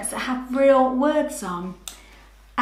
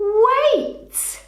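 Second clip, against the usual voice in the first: surprised wait; 12 semitones higher, 5 dB louder than usual. Real, not acted. Pausing the video.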